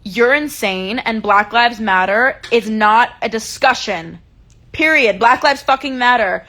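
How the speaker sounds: loud, emphatic retold comeback; retold yelling back. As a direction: shout it back at him, word by word. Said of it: "I was like".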